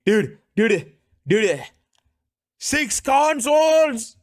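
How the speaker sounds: high, loud mock-Indian outburst; short bursts, then a stretched high shout; Indian xQc. Streamer riffing on the debater bit, Indian xQc impression. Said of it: "Why did I just do Indian X Q C?".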